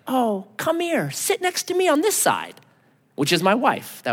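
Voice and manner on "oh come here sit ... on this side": much higher, slow and sweet; sweet daughter voice. Retold or imitated. Daughter invites a girl at camp, higher and sweet. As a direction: coax a little girl over kindly. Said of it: "So my daughter's like".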